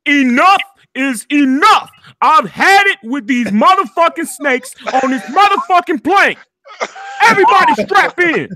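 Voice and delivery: high, loud, furious movie rant; Samuel Jackson impression. Streamer performs the chat's request, loud angry Samuel Jackson rant. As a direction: rage at the snakes like the film hero. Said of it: "so he said Samuel Jackson".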